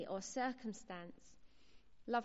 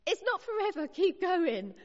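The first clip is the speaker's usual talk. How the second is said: higher, louder urging during labour; husband's urging; 8 semitones higher, 9 dB louder than usual. Retold or imitated. Husband urging his wife through labour, loud and high. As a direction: push her on like a coach at the birth. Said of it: "Mark would hold my hand and say".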